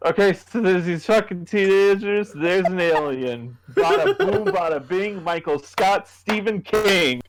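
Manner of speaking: put-on high, slurred drunk rambling with laughter. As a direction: ham up a drunk friend's pitch, slurring the words. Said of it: "in a very slurred voice went".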